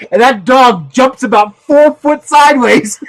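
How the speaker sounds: loud, high, slowed-down glee; gleeful storytelling. Real, not acted. Telling how the dog jumped sideways, loud glee. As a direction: relish it loudly, about to burst out laughing. Gleeful retelling of dog's startled jump.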